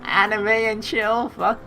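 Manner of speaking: high, slow gleeful drawl; excited. Real, not acted. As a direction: savour the words with glee. Gleeful reading of choice.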